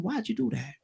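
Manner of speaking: gently asking a grandchild why; soft voice. Shown as a demonstration. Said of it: "I keep my voice like this".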